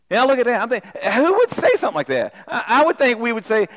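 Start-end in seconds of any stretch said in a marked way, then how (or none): none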